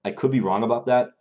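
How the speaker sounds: usual speaking voice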